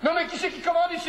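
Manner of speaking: high-pitched outraged protest; indignant telling-off. Acted. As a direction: demand it like an offended boss. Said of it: "c'est pas moi qu'il faut engueuler".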